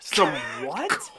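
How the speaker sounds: mildly acted incredulous question; a touch of mock confusion; baffled. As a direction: stretch WHAT in puzzlement. Baffled reply to accented offer.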